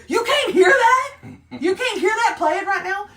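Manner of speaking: high, loud disbelieving question; can't believe his ears, laughing; incredulous Mark Wahlberg. Retold or imitated. Retelling the dad confronting the DJ, high and incredulous. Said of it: "And he said".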